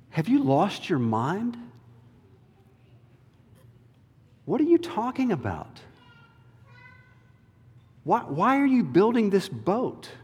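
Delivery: mock the boat builder; mocking neighbors. Scoffing people questioning Noah, high and mocking. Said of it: "the people speaking to Noah".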